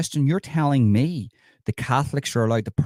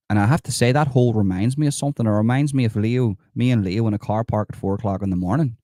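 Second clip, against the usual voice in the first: ordinary talk, nothing marked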